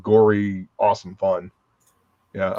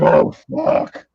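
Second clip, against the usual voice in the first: retold relief; 3 semitones higher than usual. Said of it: "and I'm like".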